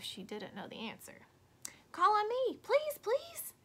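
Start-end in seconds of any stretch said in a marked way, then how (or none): 1.9-3.5 high, loud, pleading please please; a keen pupil desperate to be picked; eager begging. Acted. Eager child begging to answer.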